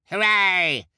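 bloodthirsty crowd cheering. A narrator's reading. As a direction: cry out in delight. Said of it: "cried several members of the crowd".

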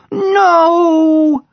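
put-on high, loud child's cry; child shout. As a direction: protest like a small girl. Child protesting.

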